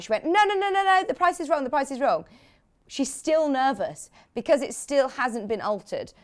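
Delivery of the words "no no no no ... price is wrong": high, rapid-fire mock alarm; mock panicked protest; retold panic. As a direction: imitate a flustered colleague, pitched up. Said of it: "she panicked".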